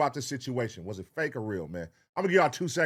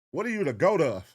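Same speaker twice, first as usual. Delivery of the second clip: mock incredulous; 6 semitones higher, faster than usual. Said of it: "Kevin Hart was like".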